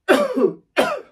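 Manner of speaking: suppressed sneeze held in; a high squeaky swallowed sneeze; stifled sneeze. Real, not acted. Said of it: "That was a sort of suppressed sneeze".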